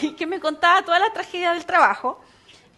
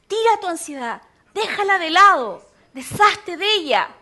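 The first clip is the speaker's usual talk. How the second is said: preaching exhortation; 4 semitones higher, 7 dB louder than usual. Acted.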